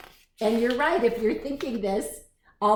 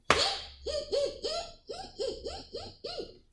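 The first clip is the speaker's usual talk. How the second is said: tiny, very high monkey ee-ee; monkey squeaks; 7 dB quieter, faster than usual. A narrator's reading. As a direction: squeak softly like a cheeky monkey. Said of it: "monkeys only stamped their feet and said".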